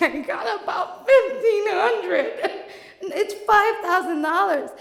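high, loud, laughing panic; hammed-up hysterical outburst; frantic rant. Panic continues.

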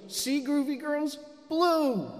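put-on high-pitched kid showing off to her toys; imitated little daughter. Daughter shows her dolls the marker, in a high child voice. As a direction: lift the voice high like a small girl. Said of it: "And she goes".

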